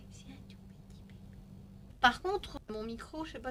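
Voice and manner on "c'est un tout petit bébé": soft fast whisper over a sleeping puppy. Real, not acted. Dog asleep on her lap.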